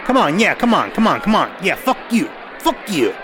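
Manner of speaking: mock taunts, each one punched out; animated egging-on. Host imitating Larry cheering on a TV fight, animated. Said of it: "Larry's getting quite animated".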